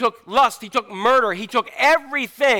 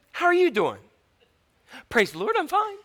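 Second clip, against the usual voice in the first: high, chirpy fake brightness; 9 semitones higher than usual. Mocking churchgoers, chirpy fake praise. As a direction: sing out a fake fine. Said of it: "Christians are really good liars".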